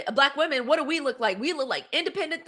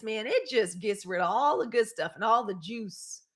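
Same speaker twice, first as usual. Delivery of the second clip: ordinary delivery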